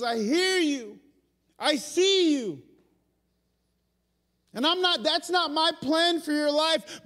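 tender God voice. Retold or imitated. God answering the depressed believer, tender reassurance. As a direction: comfort the one who is hurting. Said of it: "he goes".

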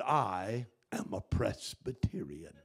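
low, soft, measured reply; prim churchwoman. Acted. Folded-hands woman answering the pastor, low and dignified. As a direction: answer with stiff, understated dignity. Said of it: "She had her hands folded".

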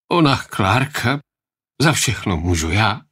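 halting, mildly tearful narrated voice; a touch of distress, breaking off unfinished; tearful confession. A narrator's reading. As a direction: own up as if close to sobbing. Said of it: "znovu se rozvzlikal".

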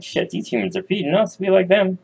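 high chirpy critter voice; high squirrels cheering about free food. Acted. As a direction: squeak it like happy squirrels. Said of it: "They're like".

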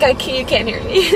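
ordinary talk, nothing marked

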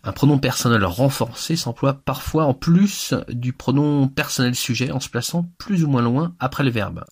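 ordinary delivery

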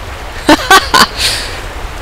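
high, gleeful laugh. Real, not acted. Gamer celebrates a landed jump, gleeful laughing. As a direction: break into high relieved laughter.

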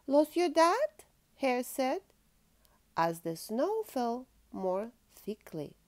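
lively storybook reading aloud; reading to children. Acted. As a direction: read it like a bedtime picture book. Reads picture book to child.

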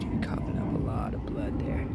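soft, low whisper. Real, not acted. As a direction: whisper it gently. Talking softly to injured fish.

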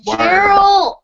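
loud, high shout of the name; calling out. Real, not acted.

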